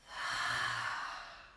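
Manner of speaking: long, breathy sigh out through the mouth; audible exhale. Shown as a demonstration. Yoga teacher demonstrating a calming breath, audible sighed exhale. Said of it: "letting it go through the mouth".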